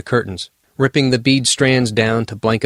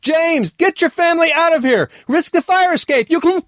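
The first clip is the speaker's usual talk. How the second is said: panicked yell. Acted. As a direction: yell it before the hand clamps down. Elf screams warning to family.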